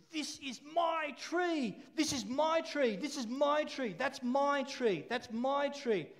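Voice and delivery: high, slow and possessive, leaning on every my; a greedy child clinging to its tree; greedy koala voice. Retold or imitated. Preacher voicing the greedy koala for the kids, high and possessive. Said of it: "this koala always says".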